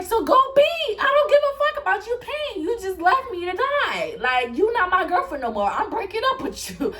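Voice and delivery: very high-pitched outraged rant, imitated; hammed-up shrieky breakup tirade; mock angry outburst. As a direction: mimic him throwing a hurt tantrum. Retold angry quarrel, imitating the friend.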